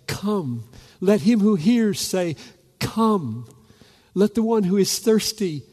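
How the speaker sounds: high, loud ringing invitation; preaching at full force. Acted. Preacher calling the thirsty to come, loud and high. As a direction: proclaim it to the whole room.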